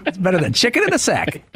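folksy saying with a put-on Southern twang; Southern expressions. Adding another expression, mock Southern accent. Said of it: "the Southern expressions".

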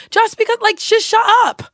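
imitating irritated friend. Re-enacting the friend's rude outburst.

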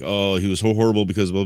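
usual speaking voice

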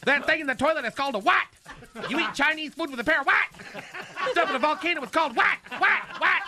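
quiz voice imitation. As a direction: rattle off the questions high and eager. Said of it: "I can't do the voice".

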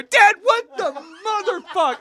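high, drawn-out tearful mock wail; crying. Son stomping downstairs to confront his dad, crying wail. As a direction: whine it through imitated tears. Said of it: "I start crying".

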